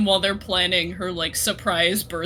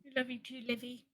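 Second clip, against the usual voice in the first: very soft, slightly higher reply; tender; 3 semitones higher, 20 dB quieter, faster than usual. Acted. Sister answering her sister's I love you, tender and hushed. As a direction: say it very quietly, with love.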